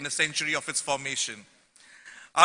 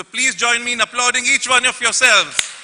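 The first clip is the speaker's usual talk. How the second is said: raised, higher call to the whole hall; rally the delegates to clap; rousing call to applaud; 5 semitones higher, 7 dB louder than usual. Real, not acted. Speaker urging seven hundred delegates to applaud, raised and rousing.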